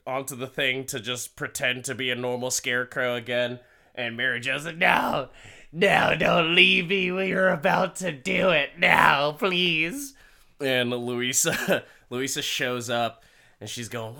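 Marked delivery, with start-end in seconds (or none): 4.8-10.1 high, loud mock begging; imitated whiny, urgent plea; desperate pleading. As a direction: plead like a jilted lover, hammed up. Acted woman begging him to stay.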